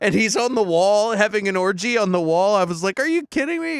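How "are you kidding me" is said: amazed disbelief. Retold or imitated. His own amazed reaction retold.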